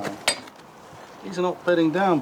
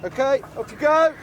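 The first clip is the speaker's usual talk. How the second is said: calling out. Real, not acted. Topside sending diver down.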